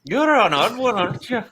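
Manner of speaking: put-on a teasing Scottish old woman accent; Scottish brogue. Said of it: "I'm not as good at the Scottish brogue".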